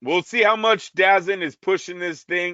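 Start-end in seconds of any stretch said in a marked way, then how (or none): 0.9-1.4 dazn said as "DAZ-in"; mispronounced name. Real, not acted. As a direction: say dazn to rhyme with "jazzin'". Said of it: "I'm saying it wrong every time".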